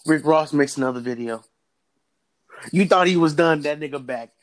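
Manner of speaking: normal talking voice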